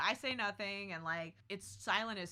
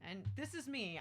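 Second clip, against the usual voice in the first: ordinary talk, nothing marked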